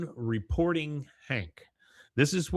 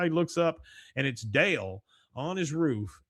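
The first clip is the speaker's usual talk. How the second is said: ordinary delivery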